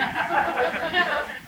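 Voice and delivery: high burst of laughter; amused laugh. Real, not acted. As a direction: break into a laugh. Teller laughs mid-story.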